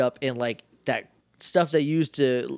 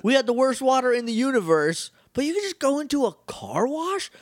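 high, incredulous comic rant; mock outrage; 9 semitones higher than usual. Viewer telling off the aliens who ignored Billy's machine, mock outrage. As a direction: scold them as if personally betrayed. Scolding characters over purified water.

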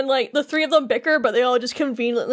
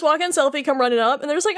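ordinary talk

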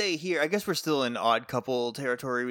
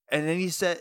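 ordinary talk, nothing marked

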